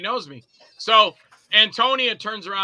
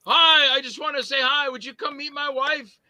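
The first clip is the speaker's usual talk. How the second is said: higher-pitched, eager introduction; pitched-up friendly request; retold eager fan; 8 semitones higher, 4 dB louder than usual. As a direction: imitate a keen fan asking a favor. Eager fan approaching him in retold story.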